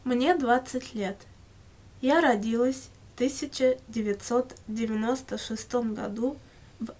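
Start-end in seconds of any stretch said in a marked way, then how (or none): none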